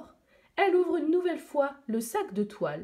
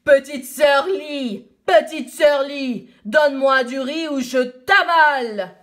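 roaring dragon; 3 semitones higher, 10 dB louder than usual. Acted. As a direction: roar the threat like a river dragon. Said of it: "le dragon du fleuve bondit en rugissant".

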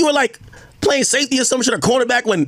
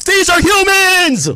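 higher, louder, slow and hammered; furious shout; 7 semitones higher, 4 dB louder, much slower than usual. Real, not acted. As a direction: blow up over every word. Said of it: "Why, why Egg crashing out?".

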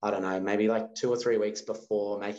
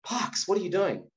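calling out; 9 semitones higher than usual. Retold or imitated. Calling to son in rough surf.

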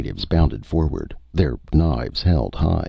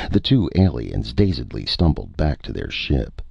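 ordinary delivery, nothing marked

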